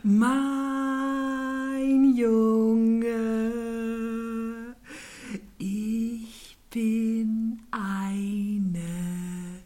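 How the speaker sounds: very slow snail drawl, NIE loud. Acted. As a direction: drag it out, calm and unhurried. Snail voice held.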